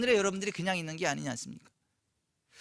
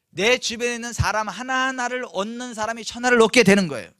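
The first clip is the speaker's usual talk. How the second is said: usual speaking voice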